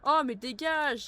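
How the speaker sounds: loud, high, slow get-lost; annoyed shoo. Real, not acted. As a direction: drive the thief away. Chasing off a game thief.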